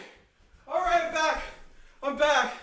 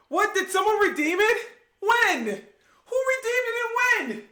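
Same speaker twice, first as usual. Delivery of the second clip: much higher, loud, disbelieving; shocked surprise; 8 semitones higher, 5 dB louder than usual. Real, not acted. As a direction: caught off guard by a redeem. Reacts to a chat redeem.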